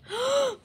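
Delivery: sharp, high shocked gasp; quick horrified intake of breath. A narrator's reading. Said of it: "Mary gasped".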